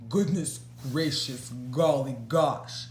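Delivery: lightly voiced posh troll; a hint of a well-spoken troll; troll sounding rather posh. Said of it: "This is how the troll spoke. He was really rather posh".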